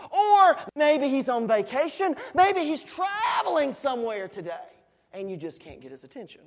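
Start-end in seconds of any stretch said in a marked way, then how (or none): 0.1-4.7 loud, high-pitched mocking guesses; raised, sarcastic jeering; Elijah. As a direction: taunt them with exaggerated speculation. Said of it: "Elijah began to taunt them".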